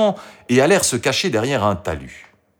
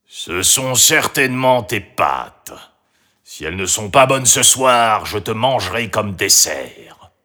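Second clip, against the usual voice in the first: grumpy. A narrator's reading. Grimont blames Marie's pasta and threatens her, grumpy. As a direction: threaten in a bad-tempered character voice. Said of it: "se réveilla de mauvaise humeur".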